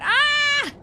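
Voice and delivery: loud, high open scream; startled scream. Real, not acted. Player out of oxygen in the game, startled scream. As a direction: shriek suddenly in alarm.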